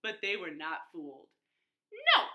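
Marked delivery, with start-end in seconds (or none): 1.9-2.3 high, loud, firm refusal; loud call. A narrator's reading. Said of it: "called the third little javelina".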